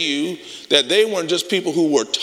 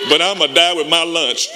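defiant vow; 4 semitones higher, 4 dB louder than usual. Retold or imitated. Retelling his defiant thought.

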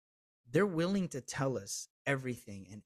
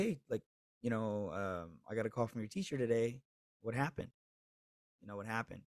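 put-on softer, calm questions to a child; gentle asking; 3 semitones lower, 5 dB quieter than usual. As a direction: ease the boy into talking. Father gently questions his son.

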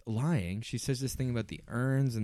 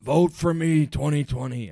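louder, higher hammed-up campaign call; Rupert impression; 4 semitones higher, 7 dB louder than usual. Still mocking Rupert, a hammed-up campaign cry. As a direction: pitch himself for president like Rupert. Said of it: "good Rupert impression".

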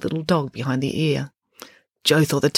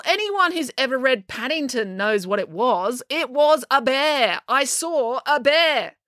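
high-pitched, adamant April voice; insistent girl; 9 semitones higher, slower than usual. A narrator's reading. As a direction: insist on it, dragging out the key words.

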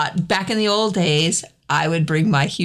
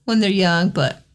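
usual speaking voice

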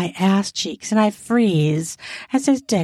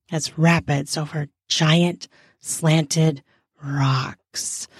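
usual speaking voice

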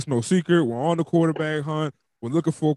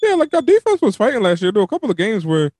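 excited; 6 semitones higher, 6 dB louder than usual. Real, not acted. Co-host jumps in excitedly.

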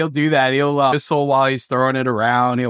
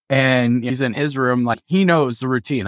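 normal talking voice

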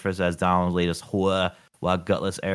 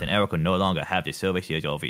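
usual speaking voice

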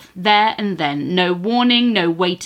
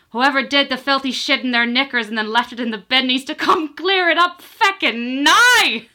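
shouting Irish landlady; 7 semitones higher than usual. Retold or imitated. Landlady yells at whole pub in an angry Irish accent. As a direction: bellow it across the pub in a thick Irish accent. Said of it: "and shouts to the entire establishment".

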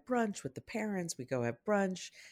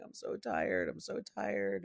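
put-on whiny tired child; 7 semitones higher, 6 dB quieter, slower than usual. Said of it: "Leon's in the back going".

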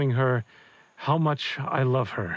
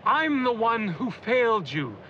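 high, shouted plea to take him; desperate shout; 9 semitones higher than usual. Acted. Father begging his dead wife to spare the kids, desperate shout. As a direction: offer yourself, crying out.

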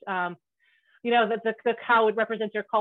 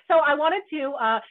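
ordinary delivery

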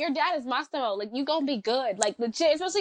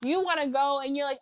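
usual speaking voice

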